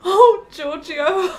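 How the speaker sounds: high, loud and slow, overwhelmed with emotion; a cry of moved surprise; gasping out his name, deeply touched; overcome exclamation. Acted. Fosca moved by friend's loyalty.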